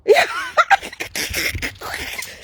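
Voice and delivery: airy high laugh; breathy high laugh. Real, not acted. Rire soufflé. (rire soufflé aigu)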